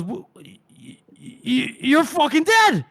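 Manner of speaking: frightened stutter building to a high shout; freaked-out stammer. Retold or imitated. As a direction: stammer in terror, then blurt it out. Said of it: "Shivers is freaking out".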